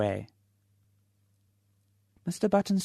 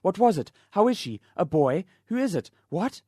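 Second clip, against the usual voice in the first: high, louder frantic questions; 7 semitones higher, 4 dB louder than usual. Acted. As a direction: tumble the questions out in a panic. Anxious father firing questions.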